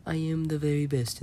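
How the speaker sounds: half-hearted mock Trump bragging, barely off his own voice; terrible Donald Trump impression. Quoting Trump to brush off a commenter, weak mock impression. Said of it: "That was a terrible Trump impression".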